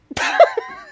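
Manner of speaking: shrieking laugh; high laugh; high squeal of laughter. Real, not acted. Laughs at contractor story.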